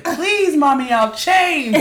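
put-on high, loud desperate child's plea; begging child. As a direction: wail it like a begging kid. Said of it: "He said".

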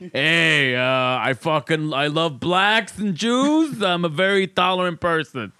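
slow, loud Jersey tough guy; Jersey accent. Retold or imitated. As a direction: lean into a thick Jersey accent. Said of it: "never been said in a Jersey accent".

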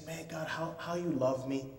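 whisper-close gratitude to God; quiet heartfelt prayer; tender awe. Retold or imitated. Praying in awe.

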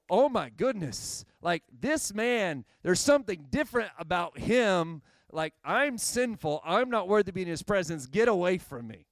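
overwhelmed by holiness, push him away; awestruck. Acted. Simon Peter voiced in awe.

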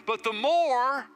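slow, drawn-out preaching over music; closing sermon exhortation. Acted. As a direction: ease into the altar call, very slowly.

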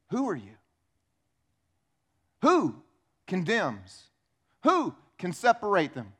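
a calm, stern rebuke. Pretend. Jesus rebuking the accuser.

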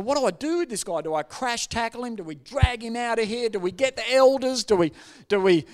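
mock panic. Pastor wondering how to handle a ranting visitor, mounting mock alarm. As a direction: build from agitated to loud and high, question on question. His panicked thoughts during the rant.

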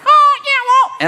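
very high, loud squeaky conscience; hammed-up high little conscience voice. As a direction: pipe it up in a tiny falsetto. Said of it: "my conscience sounds like".

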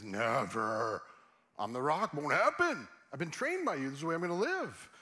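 mock-heroic, indignant boasting; defiant vow. Acting out Peter's vow to Jesus.

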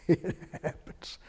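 breathy laugh through the words. Real, not acted. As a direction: let it come out mid-chuckle. Preacher laughing at sleeping congregants.

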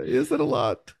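high, innocent 'is it a lot?'; mock innocent question. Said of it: "I'm like".

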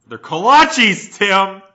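loud, high, drawn-out correction; indignant, stretched-out outburst; exasperated correction. Real, not acted. Exasperated correction of friend's mispronunciation.

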